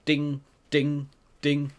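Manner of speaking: high, ringing "ding" on each strike; loud, evenly spaced anvil dings; imitated hammer clangs. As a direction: sing out each hammer blow.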